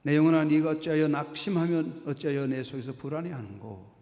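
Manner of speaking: loud, earnest reading of the psalm; scripture reading. Acted. Preacher reading the psalm to his church, loud and earnest. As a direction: proclaim the verse to the congregation.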